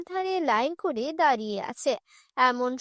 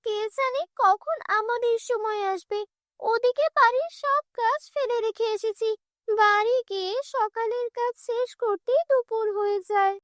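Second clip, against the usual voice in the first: village woman grumbling in a slightly raised pitch; complaining village woman; 7 semitones higher than usual. Acted. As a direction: grumble like a woman stuck in the water queue. Village woman waiting in the water queue.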